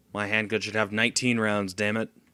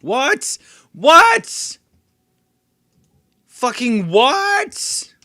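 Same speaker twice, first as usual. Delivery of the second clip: building outraged yells, each louder and higher, ending slow and drawn out; frustrated shouting; 12 dB louder, much slower than usual. Real, not acted. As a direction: bellow your disbelief at the game. Enemy spots him unexpectedly.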